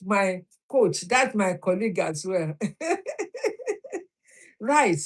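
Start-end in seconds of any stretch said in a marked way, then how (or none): 2.6-4.0 a quick, very high giggle. Real, not acted. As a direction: giggle with delight. Laughing about her coach.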